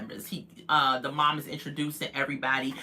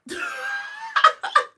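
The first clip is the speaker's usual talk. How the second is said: laughing. Real, not acted. Vlogger cracking up at the mom. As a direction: crack up at the mom's reaction.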